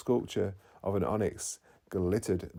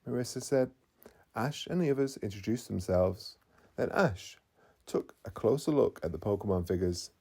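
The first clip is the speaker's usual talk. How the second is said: ordinary delivery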